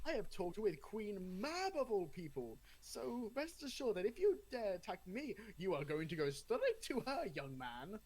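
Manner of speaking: put-on high, haughty English accent; posh English accent. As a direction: lord it over them in English. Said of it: "it ended up being very English".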